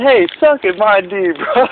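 high, cocky taunt; retold taunt. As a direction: throw it in his face.

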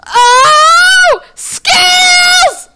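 very high, drawn-out shriek; screamed exclamation. Real, not acted. Player pulling off a game move, high triumphant-sized scream. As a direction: yell it long and high.

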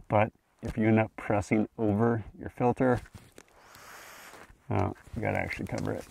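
muffled behind respirator; through a gas mask. Real, not acted. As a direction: voice inside sealed mask. Seen 0: Mask covers the speaker's face throughout; the reference frames only hold the mask.

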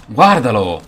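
loud burst of exclamation; sudden shout. Real, not acted. Gamer spotting an enemy mid-match, loud cry. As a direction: shout out as you spot him.

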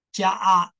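usual speaking voice

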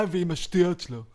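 ordinary delivery

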